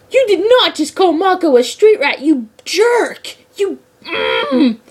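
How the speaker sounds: high, loud outraged cry of a fangirl; outraged fan yell. Retold or imitated. Fan yelling at the villain on screen, high and outraged. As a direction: yell at him for insulting Mako. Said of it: "pure rage".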